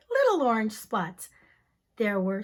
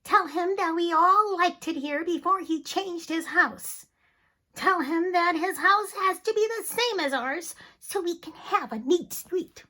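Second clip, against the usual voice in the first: higher, prim complaining voice; higher, insistent fussing; fussy neighbors; 5 semitones higher, 3 dB louder than usual. Acted. Neighbors sending the man next door, fussy and higher. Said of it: "They asked a man".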